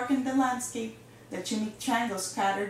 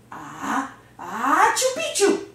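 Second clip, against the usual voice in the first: half-sneezed password; 3 semitones higher, 8 dB louder, slower than usual. Acted. Skibito gives Don Diego the secret password as a hammed-up sneeze. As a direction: build up "ah, ah" into a big squealed sneeze. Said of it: "half sneezed, half spoke the secret password".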